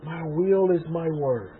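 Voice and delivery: a quiet, slow voice from within; small voice. Retold or imitated. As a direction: speak it slowly and softly like an inner voice. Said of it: "the small voice that I got".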